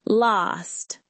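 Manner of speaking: lost said with an open, long ah, like lahst; long British ah. Shown as a demonstration. British teacher shows the long ah to the American host, open-mouthed. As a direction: stretch the ah in lost. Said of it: "I use a long ah sound".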